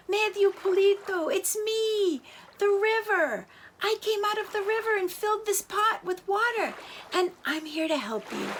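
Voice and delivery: high, friendly water spirit; a river reassuring a little chick; kindly river voice. Acted. River speaks from the pot.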